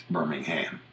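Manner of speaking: Birmingham with a full HAM ending; American pronunciation. Shown as a demonstration. Telling how Alabama and Iowa say it, Birmingham with a full last syllable. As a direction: say Birmingham as BIR-ming-HAM. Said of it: "in Alabama and Iowa".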